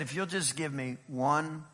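soft, low, gentle plea; quiet pleading. Acted. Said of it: "The dresser of the vineyard said".

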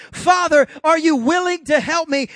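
put-on loud, high, overly proper child's plea; hammed-up child with a skinned knee asking stiffly; mock formal plea. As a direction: shout it as a stiff hurt child. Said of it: "they don't come in and say".

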